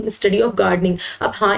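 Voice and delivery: usual speaking voice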